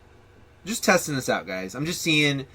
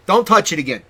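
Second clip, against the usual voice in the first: angry warning; 5 semitones higher, 8 dB louder than usual. Acted. Warning the producer.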